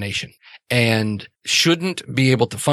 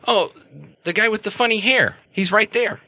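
put-on higher, quick childlike voice; little girl Mary; 10 semitones higher than usual. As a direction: point him out like an excited kid. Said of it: "she points it right at you and says".

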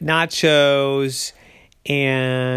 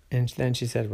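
ordinary delivery, nothing marked

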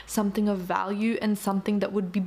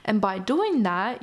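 ordinary talk

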